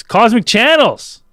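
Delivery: loud, high, drawn-out show shout; hyped radio host yell; big show announcement. Real, not acted. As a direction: belt out the show name. Host announces the show's name.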